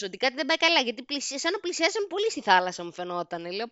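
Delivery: ordinary delivery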